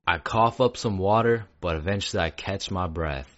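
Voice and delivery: plain reading voice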